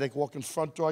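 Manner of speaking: normal talking voice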